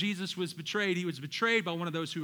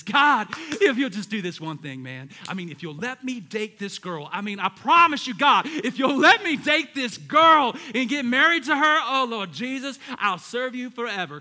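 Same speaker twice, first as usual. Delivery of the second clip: put-on kid bargaining prayer; 4 semitones higher, 10 dB louder than usual.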